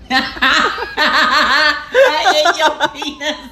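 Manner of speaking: high, words breaking into laughter; helplessly laughing through the words; laughing hard. Real, not acted. Getting the joke while spelling it out, real laughter.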